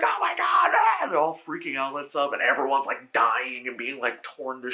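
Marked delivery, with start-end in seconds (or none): 0.0-1.1 very high, loud mock scream of terror; panicked scream. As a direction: shriek it like monsters are coming. Monsters attack, people panic.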